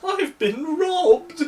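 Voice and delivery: very high, slow, wailing cry; hysterical. Acted. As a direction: wail it in panic. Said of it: "and she was hysterical".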